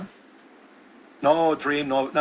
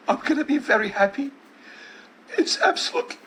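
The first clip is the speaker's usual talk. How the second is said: dying daughter's voice; 4 semitones higher than usual. Retold or imitated.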